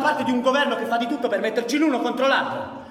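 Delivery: high, loud indignant shouting with a bitter laugh; heated shout at an old comrade; angry outburst. Acted. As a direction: fire back at him, offended.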